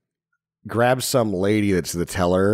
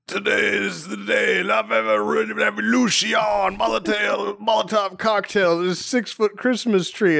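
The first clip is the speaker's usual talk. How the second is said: ranting movie Santa; 12 semitones higher than usual. Retold or imitated. Movie Santa declaring his attack on the mall tree, mock-heroic cry.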